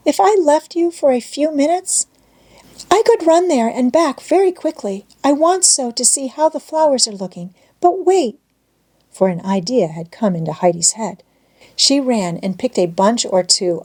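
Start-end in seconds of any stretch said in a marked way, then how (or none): 0.1-8.4 put-on high, eager little girl voice; a girl who wants to run to the flowers; hesitant. A narrator's reading. Heidi asking Clara to let her go, high eager girl. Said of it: "rather hesitatingly".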